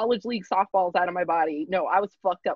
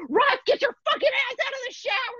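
high yell through the door; pounding on the bathroom door, high yell at roommate. Retold or imitated. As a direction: pitch up high and yell at him to get out. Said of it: "I'm like".

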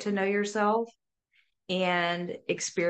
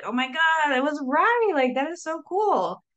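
high, excited gush; delighted realisation; 9 semitones higher, 4 dB louder than usual. Acted. Looking back on a written idea that paid off, delighted. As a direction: exclaim it in amazed delight, pitched right up.

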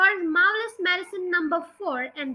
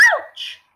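sudden high, loud "ouch"; high yelp; 5 dB louder than usual. A narrator's reading. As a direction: yelp it like a startled hen. Hen yelps in the story.

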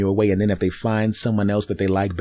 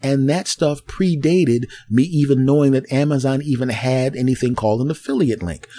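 normal talking voice

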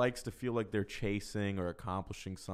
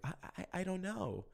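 high, hesitant stammer, mildly imitated; unsure stammer; 8 semitones higher, 6 dB quieter than usual. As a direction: stumble over it like a shy young girl. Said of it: "She'd be like".

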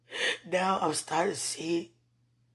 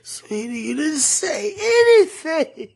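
sobbing yell, slow and high; crying shout; 11 semitones higher, 11 dB louder, slower than usual. Real, not acted. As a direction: wail it through tears. Crying outburst at spouse.

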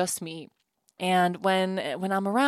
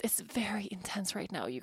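whisper; 9 dB quieter than usual. Real, not acted. Confiding exhaustion to listeners.

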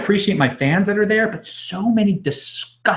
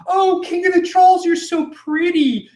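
high, swooning fake flattery; mock fawning; 13 semitones higher than usual. Host mimicking King of the Trolls' admirers, fawning mockingly. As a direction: coo sarcastically like a besotted follower.

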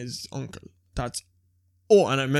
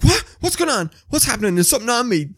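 high-pitched imitation of a panicked girlfriend; freaking out; 8 semitones higher, 4 dB louder, faster than usual. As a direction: act out her rising alarm. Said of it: "And she freaked".

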